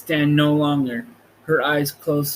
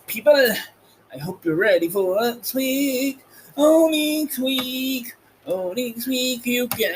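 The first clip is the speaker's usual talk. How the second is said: sing-song teasing; 9 semitones higher than usual. Real, not acted. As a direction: stretch out "next week" with a sing-song lilt. Playful teasing of listeners.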